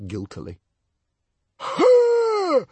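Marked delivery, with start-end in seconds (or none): 1.6-2.6 very high, loud triumphant shout; the librarian catching Stoic out, crowing; triumphant cry. A narrator's reading. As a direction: crow in triumph. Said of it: "cried the librarian triumphantly".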